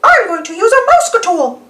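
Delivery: high cartoon mouse voice; high and confident; Mickey Mouse. Mickey deciding a plan, high and sure. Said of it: "Mickey says".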